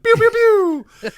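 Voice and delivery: playful "pew pew pew" gun noises; mock laser shots. Host imitating moderators shooting bad comments down, playful pew sounds. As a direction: fire off pretend laser shots with the mouth.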